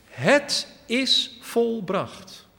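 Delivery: high, slow, ringing cry of Jesus; loud cry. Acted. Jesus on the cross calls out in a loud, slow voice. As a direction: call it out slowly and loud, the last word from the cross. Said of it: "Dat riep hij met luider stem".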